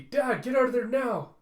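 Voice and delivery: high, loud panicked shout; frantic yell. Acted. Telsa shrieking at her dad to escape. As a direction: scream the warning.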